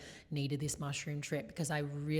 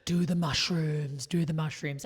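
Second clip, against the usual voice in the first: insistent, creepy mock coaxing; hammed-up eerie recruiting chant; creepy cult leader; 4 dB louder than usual. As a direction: imitate a cult leader luring someone in. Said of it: "it actually was creepy. I'm like".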